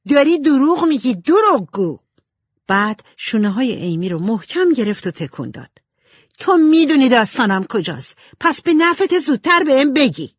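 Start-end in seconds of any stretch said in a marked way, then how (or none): 0.1-2.0 high, loud accusing shout; angry shout. A narrator's reading. Jo accusing her sister of lying, angry shouting. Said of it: "جو داد زد". 6.4-10.3 high, loud, threatening demand; angry shouting. A narrator's reading. Jo shaking her sister over missing story, angry shouting. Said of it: "جو داد زد".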